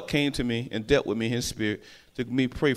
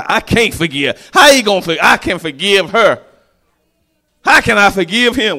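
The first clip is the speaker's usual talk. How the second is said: protesting cry; 6 semitones higher, 10 dB louder than usual. Retold or imitated. Quoting someone who refuses to forgive, loud and high. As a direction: cry out that you can't forgive. Unforgiving person quoted, loud.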